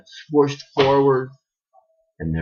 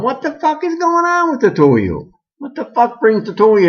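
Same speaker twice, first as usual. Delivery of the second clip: shrill demand; 12 semitones higher than usual. Acted. Janet demands an explanation.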